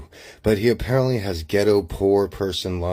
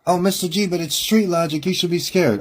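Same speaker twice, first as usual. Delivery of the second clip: much higher-pitched mock voice; mocking objector; 11 semitones higher than usual. Imagined objector warning Mister G about street logic, in a high mocking voice. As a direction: imitate a doubter in a high, put-on voice.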